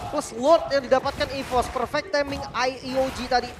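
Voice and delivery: loud, high, slow hype call; esports caster. Real, not acted. Caster calls a Lord take.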